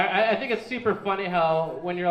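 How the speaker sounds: normal talking voice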